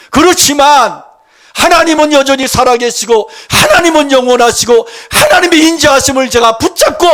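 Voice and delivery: loud, high-pitched fervent confession; full-force preaching. Acted. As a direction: cry out faith through hardship, easing at the very end. Preacher voices a fervent confession of faith. (온 힘을 다해 외치는 신앙 고백 설교)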